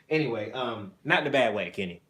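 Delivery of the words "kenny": normal talking voice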